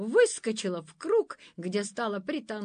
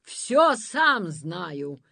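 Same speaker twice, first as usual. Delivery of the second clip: very slow, louder words; drawn-out irritation; 7 dB louder, much slower than usual. Acted. Monster fretting, slow irritated drawl. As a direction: drag each word out impatiently.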